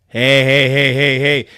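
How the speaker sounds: loud, cutting in hey hey; sudden loud hey to break it off; loud interruption. Real, not acted. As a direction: stop the reading with a loud call. Interrupting his own reading.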